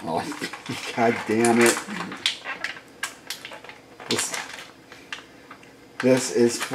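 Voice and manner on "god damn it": normal talking voice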